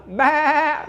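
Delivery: high, drawn-out ghostly bah; ghost sound. Shown as a demonstration. Teacher demonstrating a ghost sound to listeners, high ghostly bah. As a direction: moan it like a ghost. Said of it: "ghost voice".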